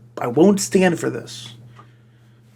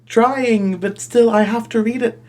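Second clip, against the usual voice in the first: mock exasperated; 6 semitones higher, 3 dB louder than usual. Real, not acted. Complaining about reading fanfic.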